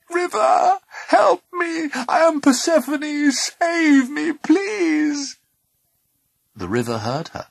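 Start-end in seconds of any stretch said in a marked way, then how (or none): 0.1-5.4 high, loud desperate plea; crying out. A narrator's reading. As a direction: call for rescue in a piercing cry. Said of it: "she cried out".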